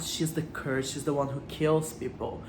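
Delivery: normal talking voice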